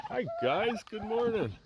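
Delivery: high-pitched hello to pets; greeting the animals. Real, not acted. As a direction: call out a high, sing-song greeting to the turkeys. Greeting his turkeys in high voice.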